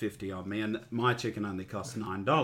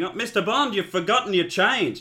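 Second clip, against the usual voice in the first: calling out; 9 semitones higher, 6 dB louder than usual. Retold or imitated. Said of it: "the checkout person yelled after him".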